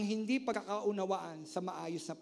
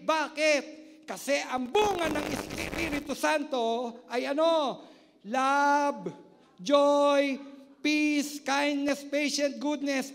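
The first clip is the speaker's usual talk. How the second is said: loud fervent sermon, listing the fruits; full-force preaching; 4 semitones higher, 7 dB louder than usual. Acted. Pastor rousing the congregation about the Spirit, loud preaching. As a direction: proclaim it to the congregation.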